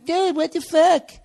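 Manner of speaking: high mocking complaining dude; mock an annoyed fan; whiny listener.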